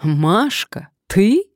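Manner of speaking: put-on thrilled to spot an old friend; joyful exclamation. A narrator's reading. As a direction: call out in happy disbelief, ending high. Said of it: "вдруг радостно воскликнул".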